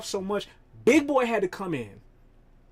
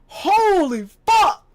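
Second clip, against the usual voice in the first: high, loud, slow disbelief; stunned shout; 12 semitones higher, 9 dB louder, much slower than usual. Real, not acted. Realizing the song's age, high stunned shout. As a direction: shout it like the years just hit. Realizing how old the song is.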